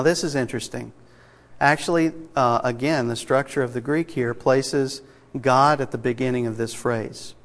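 ordinary delivery

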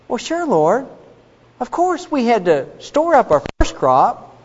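high-pitched, lightly acted self-justifying; mock excuse-making. Cain answering the Lord about his crop, mock defensive.

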